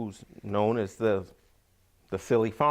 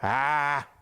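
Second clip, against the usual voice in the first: higher, louder echoed "ah"; silly farmer's imitating "ah"; Saeed echoing; 5 semitones higher, 5 dB louder than usual. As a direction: echo her gasp higher and louder. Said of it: "And then Saeed went".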